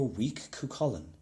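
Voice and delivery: ordinary delivery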